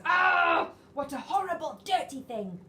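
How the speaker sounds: loud high yell of disgust. Acted. Parents yell at the dirty Bunny in disgust. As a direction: yell it at the filthy toy. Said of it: "And they yelled".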